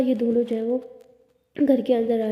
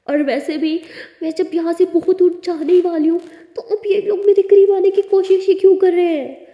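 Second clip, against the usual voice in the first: hurt outburst; 5 semitones higher, 7 dB louder than usual. Acted. Tara pours out her hurt.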